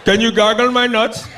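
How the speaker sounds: put-on loud, high, slow East Indian accent; hammed-up East Indian accent with rolled gargle. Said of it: "that East Indian accent".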